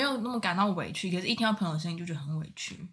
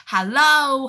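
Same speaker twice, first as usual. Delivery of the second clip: bright, energetic phone greeting, pitched up and louder; 很精神; 6 semitones higher, 11 dB louder than usual. Retold or imitated. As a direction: chirp a cheery hello into the phone. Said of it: "就是很精神这样". (模仿朋友很精神地接电话)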